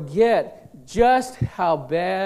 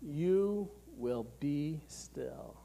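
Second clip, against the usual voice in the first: soft and slow; 4 semitones lower, 13 dB quieter, much slower than usual. Shown as a demonstration. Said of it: "literally means this".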